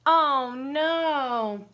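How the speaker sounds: normal talking voice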